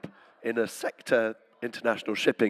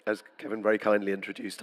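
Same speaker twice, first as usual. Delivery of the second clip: ordinary delivery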